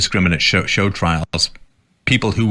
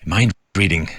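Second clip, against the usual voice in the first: usual speaking voice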